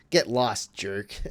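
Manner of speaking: teasing a friend over a bad pick, loud Get. Mock insult to a friend.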